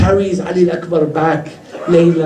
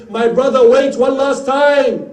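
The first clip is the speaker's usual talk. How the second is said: high, drawn-out pleading call; 8 semitones higher, slower than usual. Acted. Zainab calls her brother back from battle, crying out. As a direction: raise the pitch and stretch the last words.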